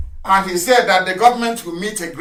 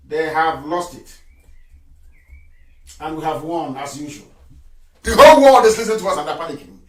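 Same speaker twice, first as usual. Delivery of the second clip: ordinary talk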